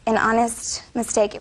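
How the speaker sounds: ordinary talk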